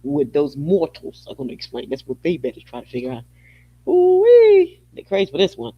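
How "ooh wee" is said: high loud delighted holler; ooh-wee, loud and high; gleeful ooh-wee. Real, not acted. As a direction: holler with glee. Gleeful exclamation.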